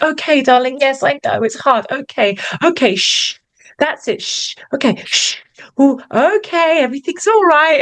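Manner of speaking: high-pitched hurried soothing with shushes; acted anxious cooing, pitch climbing; loving but stressed parent. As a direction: shush a crying child, trying to sound loving. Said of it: "if there's more love in there".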